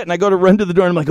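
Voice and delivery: ordinary delivery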